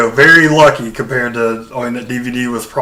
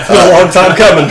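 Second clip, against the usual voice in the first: said through a real laugh, louder; 7 semitones higher, 7 dB louder than usual. Real, not acted. Cohost laughs along at the host's excitement, laughing. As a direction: laugh the words out.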